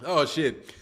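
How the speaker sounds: ordinary talk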